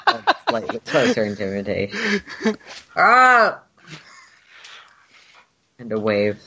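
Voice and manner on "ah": high, restrained creature roar; an intimidating Wookiee call, reined in; held-back Wookiee roar. Acted. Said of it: "trying not to ruin anyone's ears by doing".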